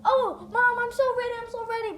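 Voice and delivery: put-on high, loud, eager kid voice; excited son telling mom he's ready; happy and excited. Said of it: "Izuku is all happy".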